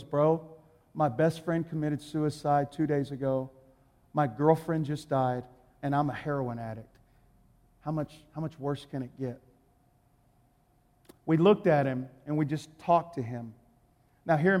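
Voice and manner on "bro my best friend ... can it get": low, quiet voice of a man worn down; despondent retelling. Retold or imitated. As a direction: imitate a broken man's confession, quietly. Homeless addict retells his tragedies.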